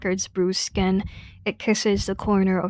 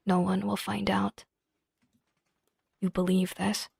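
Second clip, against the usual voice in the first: hushed story narration; 4 dB quieter than usual. Acted. Reading the game's dark ending to viewers, hushed.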